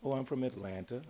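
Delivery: ordinary delivery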